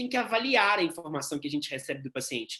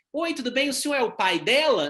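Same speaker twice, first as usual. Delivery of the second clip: nurse quote; 5 semitones higher, 4 dB louder than usual. Retold or imitated. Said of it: "Nunca faça inferências".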